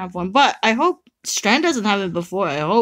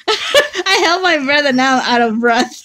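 giggling breathlessly; laughing, out of breath; 5 semitones higher than usual. Real, not acted. Laughing to her partner after holding her breath. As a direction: laugh through the words, winded.

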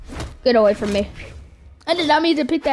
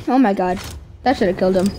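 ordinary talk, nothing marked

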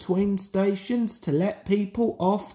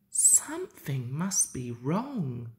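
softer, lower, to himself; quiet thought; 4 semitones lower, 6 dB quieter than usual. A narrator's reading. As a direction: think it quietly, a touch uneasy. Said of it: "thought Thomas".

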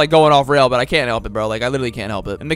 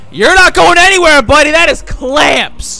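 taunt; 8 semitones higher, 8 dB louder, slower than usual. Acted. Taunting a beaten player.

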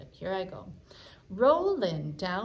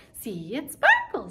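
sparkle sung up high and loud; 4 semitones higher, 8 dB louder than usual. Shown as a demonstration. Teacher going high on the rhyme for kids. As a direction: lift your voice high on "sparkle". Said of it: "where my voice went high".

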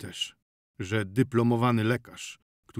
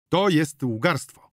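louder, slowed, every word hammered; slow angry accusation; 5 semitones higher, 7 dB louder, slower than usual. Acted. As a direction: spit out the accusation slowly. Doctor accuses healer of lying. (gniewnie i powoli)

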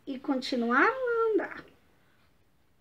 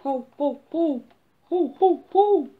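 barky ho ho growing louder; building dog bark; 6 dB louder than usual. Acted. Big dog bursts out barking at Coquinho, building bark. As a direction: bark it out, louder each time. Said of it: "o cachorro apareceu assim".

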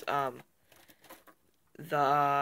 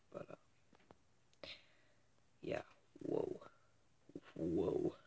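hushed; 11 semitones lower, 12 dB quieter than usual. Real, not acted. Fading hushed ending.

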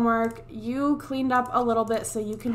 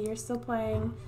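usual speaking voice

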